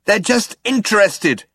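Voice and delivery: high-pitched insistent shout. A narrator's reading. Zaphod shouts down the crew's worries, insistent. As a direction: insist over their worries. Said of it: "shouted Zaphod".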